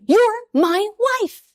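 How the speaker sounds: high, drawn-out imitation of an appalled husband; mock outrage. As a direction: appeal slowly and high, hammed-up indignation. Retold exam quarrel with husband.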